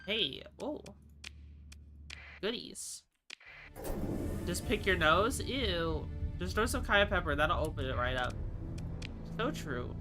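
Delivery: ordinary delivery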